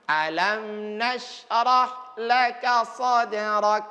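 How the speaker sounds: slow recitation, lips moving only on the m; careful lip movement. Shown as a demonstration. Teacher shows lip movement, slow. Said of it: "perhatikan baik-baik kalau sedang ulang".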